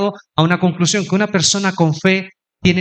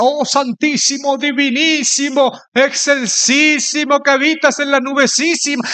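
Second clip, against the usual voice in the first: imitating flowery prayer; 8 semitones higher than usual. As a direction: stretch every superlative in mock reverence. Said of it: "un hermano que oraba tan lindo y siempre decía".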